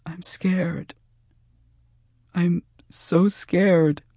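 high, thin, tearful fear; scared girl on the edge of sobs; frightened sobbing. Acted. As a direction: whimper it high, drawing out the words. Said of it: "She started to sob".

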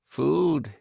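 short, understated indifferent word. A narrator's reading. Woman hands the stranger a packet, indifferent. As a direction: offer it with little interest. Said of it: "said in the same indifferent tone".

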